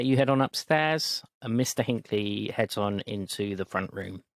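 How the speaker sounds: ordinary delivery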